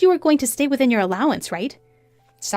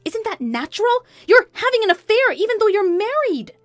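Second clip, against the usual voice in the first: high, raised scolding; sharp retort; 12 semitones higher, 4 dB louder than usual. Acted. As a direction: throw the blame straight back. Wife retorts to mistress.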